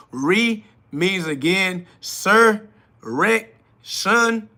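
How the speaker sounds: "Re" slow, then "sur", "rec", "shun" each split off one by one; syllable breakdown. Shown as a demonstration. Streamer breaking a word into its syllables for chat. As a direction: spell out "sur", "rec", "shun" as separate beats. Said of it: "The word resurrection".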